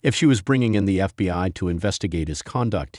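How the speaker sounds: usual speaking voice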